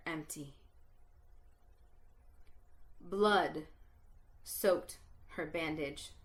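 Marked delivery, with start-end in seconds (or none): none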